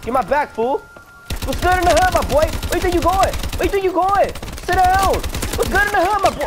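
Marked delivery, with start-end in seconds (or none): 1.5-6.4 high, loud street taunt; hyped taunt. Pretend. Gamer taunting enemy players, high swaggering greeting.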